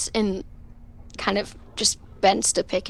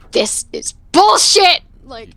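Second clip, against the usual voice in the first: louder, higher angry snap; frustrated outburst; 7 semitones higher, 7 dB louder than usual. Retold or imitated. Aspen storming downstairs, in an angry outburst. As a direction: blow up at the unfair gift. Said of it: "he starts getting more frustrated".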